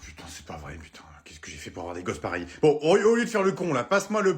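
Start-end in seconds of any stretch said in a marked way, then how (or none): 0.0-2.0 exasperated whisper under the breath; whispered curse. Acted. Exasperated father whispering a curse about his kids. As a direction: hiss it to yourself in despair. Exasperated dad swearing aside.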